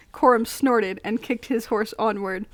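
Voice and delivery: usual speaking voice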